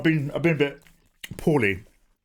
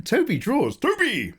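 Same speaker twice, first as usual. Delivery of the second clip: excited greeting; 10 semitones higher than usual. Real, not acted. Greeting arriving chat member excitedly.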